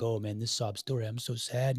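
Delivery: soft, low mock sympathy; understated put-on sadness, quietly voiced; mock bleeding heart. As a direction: imitate a bleeding heart, hushed and sorry. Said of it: "you don't have to be a bleeding heart".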